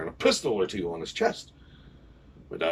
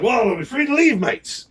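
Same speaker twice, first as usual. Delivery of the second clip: much higher, loud cheeky pirate question; pirate sign-off; 13 semitones higher, 5 dB louder than usual. Retold or imitated. Pirate asking the judges if they may go, cheeky. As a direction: ask to leave with a grin.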